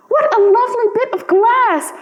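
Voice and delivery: put-on high, delighted child's cry; a child's high delighted exclamation; little girl crying out. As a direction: squeal it like a thrilled small girl. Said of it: "cried the little girl".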